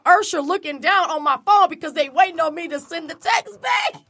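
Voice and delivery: usual speaking voice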